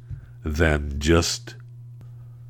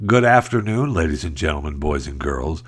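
normal talking voice

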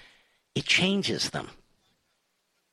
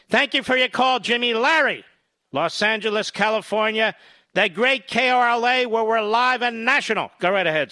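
high, bright on-air announcer delivery; radio host patter; 10 semitones higher than usual. Real, not acted. Radio host switching callers on live national air, announcer style. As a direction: roll into the next call at full host energy. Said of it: "host's call-screening patter".

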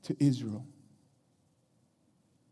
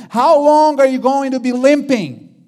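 loud, high-pitched public challenge; challenging; 8 semitones higher, 11 dB louder than usual. Retold or imitated. Preacher as Elijah confronting all Israel at Mount Carmel, challenging. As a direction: throw the challenge out to the whole crowd. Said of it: "he comes in already challenging everybody".

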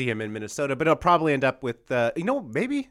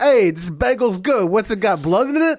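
slow, higher New York vampire; New York accent; 7 semitones higher, 5 dB louder, slower than usual. Retold or imitated. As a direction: drawl it like a Long Island vampire. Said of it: "the Long Island vampire".

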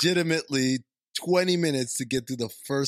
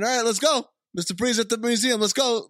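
louder, quick, pushy urging; mock hurry; 6 semitones higher, 5 dB louder, faster than usual. Cohost mocking the rushed film opening, loud and quick. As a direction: rush everyone along.

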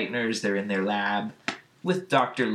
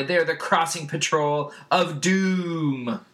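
raised pitch, stretched out for mock menace; melodramatic proclamation; 6 semitones higher, slower than usual. Beast Boy names the crossing patrol, mock-ominous. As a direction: announce it like a cartoon catastrophe.

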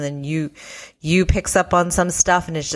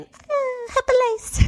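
put-on cutesy girl voice; slower than usual. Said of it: "looking up and saying".

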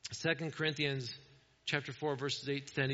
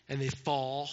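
ordinary talk

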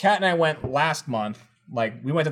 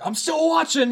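imitated shout. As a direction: imitate a shout by jumping way up in pitch. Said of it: "he just, he shouts".

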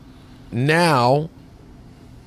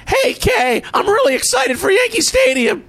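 Marty McFly impression. Asked by Dan Riley, yelling in a high Marty voice. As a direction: shout it like a hyped-up Marty McFly. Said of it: "your Marty McFly imitation".

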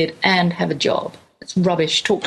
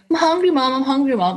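imitated kids' plea, much higher at the start; pestering children; 6 semitones higher than usual. Hungry children in the car beg Mom to stop, imitated pestering. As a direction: whine it like hungry kids, falling on each "Mom".

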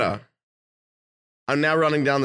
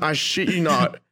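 usual speaking voice

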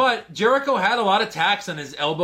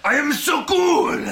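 higher, slow, drawn-out braggart voice; mocking show-off; 7 semitones higher, slower than usual. Mocking the wrestler's hang loose move, exaggerated show-off voice. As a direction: stretch it out as a ridiculous show-off.

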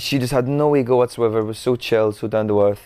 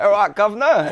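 hammed-up Cockney accent, higher and brighter; mock Cockney accent; 11 semitones higher, 3 dB louder than usual. Actor mocks his own Cockney accent on the show, in Cockney. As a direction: play up the Mockney Cockney accent. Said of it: "Mockney Cockney accent".

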